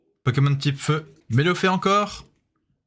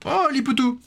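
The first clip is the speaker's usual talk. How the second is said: much higher, thrilled "Oh" at a favourite card; delighted surprise; 9 semitones higher than usual. Real, not acted. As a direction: squeal with joy at the card.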